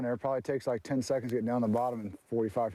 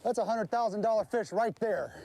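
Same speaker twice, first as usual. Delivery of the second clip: high, thrilled announcement; shrieking jackpot winner; 9 semitones higher than usual. Real, not acted. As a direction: crow about the big win. Fish landed, winning.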